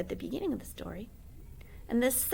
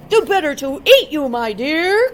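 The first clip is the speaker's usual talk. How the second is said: loud, higher pouncing wolf; wolf's cry; 7 semitones higher, 15 dB louder than usual. Acted. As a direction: roar it as you pounce. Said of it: "cried the wolf".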